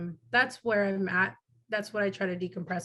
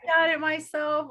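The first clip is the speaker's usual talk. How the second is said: mock rooftop yell; 8 semitones higher, 4 dB louder, slower than usual. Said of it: "yell at the top of the roof".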